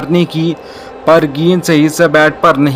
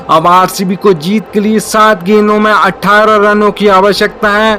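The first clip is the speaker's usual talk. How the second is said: excited cricket commentary; 4 semitones higher than usual. Real, not acted. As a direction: build up as the ball flies. Live call of a six.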